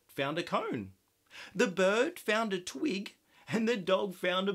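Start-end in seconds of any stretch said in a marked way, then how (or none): none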